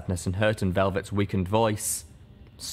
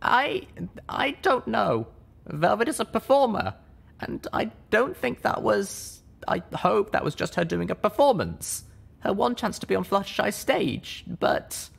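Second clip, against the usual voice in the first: much higher, halting and unsure; unsure young mare; 12 semitones higher than usual. Acted. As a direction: trail off doubtful. Littlepip unsure.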